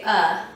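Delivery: short, loud "uh" schwa; schwa sound. Shown as a demonstration. Teacher voicing the schwa for students. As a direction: say the bare "uh" sound. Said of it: "any vowel can say".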